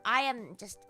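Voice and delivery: normal talking voice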